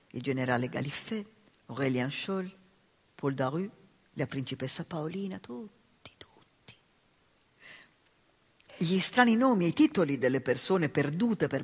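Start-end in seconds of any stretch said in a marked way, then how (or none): 1.7-6.7 names trailing into a whisper, voice dying away; fading whisper. Acted. Babette mourning her lost patrons, fading to a whisper. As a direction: let the last words vanish into breath.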